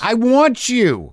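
slow mock-sincere declaration; mock earnest. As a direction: say it slowly, overly sincere.

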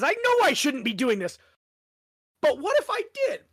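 mischievous question rising very high; cheeky what-if rising up; quoting Harry. Retold or imitated. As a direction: tempt yourself, ending in a high squeak. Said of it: "that's kind of who Harry is".

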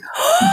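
sharp high gasp of delight; big excited gasp. Real, not acted. Host hinting at an engagement ring, high delighted gasp. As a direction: gasp as if hearing a proposal.

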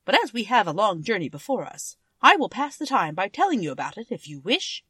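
a hint of a royal monkey beginning a tale; Monkey King voice. Monkey King answers Dorothy, lightly voiced.

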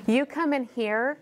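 ordinary delivery

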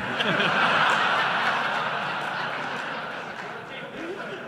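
long wheezing laugh; real breathy laughter. Real, not acted. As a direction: laugh silently at the joke. Laughter after punchline.

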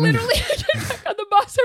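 high voice squeezed by real laughter; laughing. Real, not acted. Storyteller telling of her return to the bus, laughing. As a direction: push the words out through giggles.

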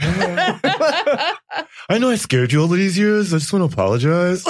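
high squeaky rat, laughing through it. Acted. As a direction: play a chilled-out rat saying sorry. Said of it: "Is that your rat voice?".

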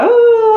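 high, loud wail while veering off; imitated out-of-control cry; long rising whoop of mock panic; drawn-out alarmed "oooh". Swerving away on skis.

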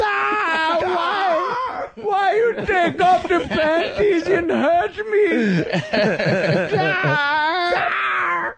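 high, hammed-up wailing cry; mock cry. Mocking the clip's victim, high hammed-up wailing. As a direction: wail in a high pretend hurt voice.

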